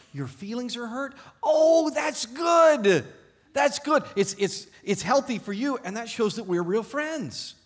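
high, loud, exaggerated delight; mock-thrilled wife cooing at a child; gushing smiling delight. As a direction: gush over it in a sing-song, smiling. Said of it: "She smiled and she looked at the girl".

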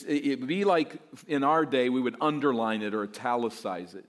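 usual speaking voice

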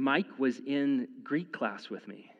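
ordinary delivery

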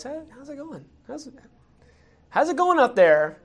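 a hint of a smooth king making small talk; breezy King David greeting. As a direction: play it friendly and offhand, like nothing's wrong. David greets Uriah back home.

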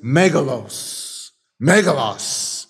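"megalos" slowly, each syllable drawn out; careful slow Greek "Megalos"; Greek word. Shown as a demonstration. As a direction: say "megalos" slowly, MEH-ga-los. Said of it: "he uses an emphatic Greek word".

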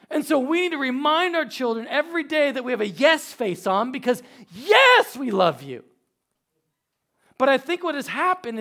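high, loud fervent preacher voice; preaching. Real, not acted. Pastor exhorting parents, preaching. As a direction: press it home to the church.

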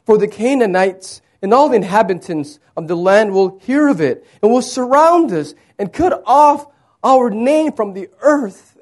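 impassioned scripture plea, high and loud; crying out. Acted. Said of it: "he's crying out to God".

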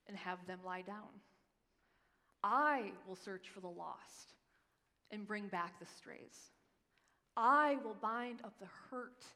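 slow, deliberate promises, softening at the end; slow scripture reading. Acted. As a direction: read each promise slowly and tenderly. Reads God's promises slowly.

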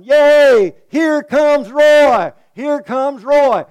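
high, loud cheering shout, drawn out slowly; kids hollering. Retold or imitated. Theater kids cheering for Roy, loud high shout. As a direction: cheer like a kid at the Saturday matinee. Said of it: "start hollering".

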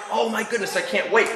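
lightly voiced excited toad. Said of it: "And Toad is so excited".

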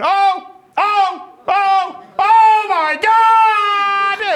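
loud, very high squawking screams, last one drawn out; screeching parrot. Retold or imitated. Parrot crying out as its cage falls, screeching. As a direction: shriek like a parrot in a falling cage. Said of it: "the bird is going".

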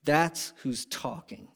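normal talking voice

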